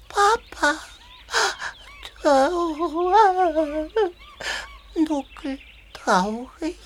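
ordinary talk

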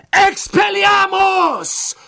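high, drawn-out battle cry of a spell; shouted spell. A narrator's reading. Harry hurls his spell at Voldemort, shouting it high and long. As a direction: yell the incantation at full force, stretched out. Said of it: "Harry rief".

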